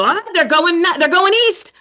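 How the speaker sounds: a hint of a child blurting an answer; kids' guess. Pupils guessing the direction, lightly voiced. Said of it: "The kids will go".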